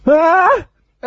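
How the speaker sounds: high, loud charging yell; battle yell. Pretend. Charging in, yelling.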